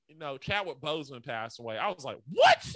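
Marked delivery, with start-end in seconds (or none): 2.3-2.7 high, loud shocked cry; shocked what. Retold or imitated. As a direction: blurt it out in disbelief. Shocked reaction to death news.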